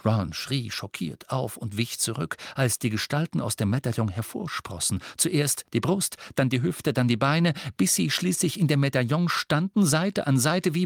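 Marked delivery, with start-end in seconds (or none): none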